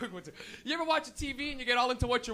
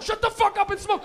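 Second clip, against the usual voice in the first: mock angry snap; 7 semitones higher, 7 dB louder than usual. Friend snapping in the car.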